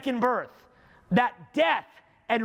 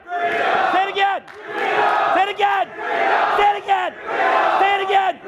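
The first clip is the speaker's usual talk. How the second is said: high, relentless shout, growing ever louder; building rallying shout; 8 semitones higher, 9 dB louder than usual. Real, not acted. Speaker driving the audience's freedom chant, building rallying shout. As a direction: shout each command on one high pitch, building.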